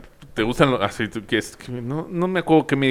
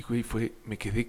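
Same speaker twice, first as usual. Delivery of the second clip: normal talking voice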